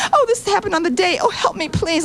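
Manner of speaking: high-pitched mock victim plea; mock helpless pleading. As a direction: imitate a helpless complainer, pitched well up. Mocking women running to committees.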